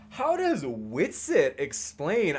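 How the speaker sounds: slow, drawn-out sassy read with swooping pitch. Retold or imitated. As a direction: put her sass on every word. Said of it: "the way she says it with her sass".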